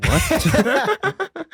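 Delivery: squeaky, very high confused what; high-pitched what. Retold or imitated. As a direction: squeak it out in disbelief. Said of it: "then he'd be like".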